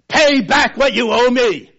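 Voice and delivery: loud, high furious demand; angry demand. Acted. Servant to his debtor, a loud furious demand. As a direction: bark the debt at him, a creditor choking a man. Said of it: "he demanded".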